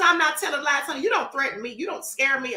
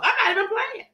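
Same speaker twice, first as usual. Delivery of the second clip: half-laughing insist; 7 semitones higher than usual. Real, not acted. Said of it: "I am so serious".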